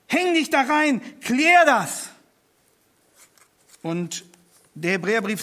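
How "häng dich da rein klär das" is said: urgent appeal. Acted. Preacher urges the congregation.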